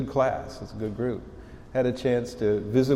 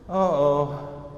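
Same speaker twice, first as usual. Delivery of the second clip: a sung "Uh-oh" with a comic sense of trouble; mock-dread, sing-song "Uh-oh"; 4 semitones higher, 4 dB louder than usual. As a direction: sing it like you know you're caught. Reacting to the accented caller.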